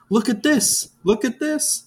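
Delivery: high, sing-song elf voices. Retold or imitated. Quoting the elves who offer impossible toys, sing-song high.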